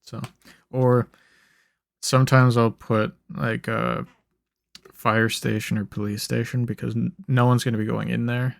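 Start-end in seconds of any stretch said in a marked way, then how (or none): none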